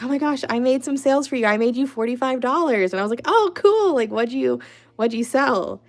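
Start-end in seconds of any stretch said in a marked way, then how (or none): 0.0-2.9 high, quick, gushing imitation of mom; excited mom. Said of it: "she's all excited". 3.2-5.8 high, louder, eager reaction; retold delight. Retold reply to mom's good news.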